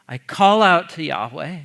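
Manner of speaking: slow, solemn reading of the psalm; scripture reading. Acted. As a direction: let each line land, slowly. Reads the psalm aloud.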